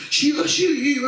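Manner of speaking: high-pitched mock prayer cry; imitated shouted praying. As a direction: cry it out along with the shouting crowd. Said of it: "כולם צועקים".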